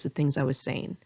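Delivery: usual speaking voice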